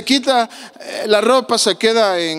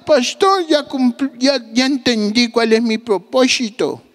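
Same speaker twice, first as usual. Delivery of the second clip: put-on old man voice; 5 semitones higher than usual.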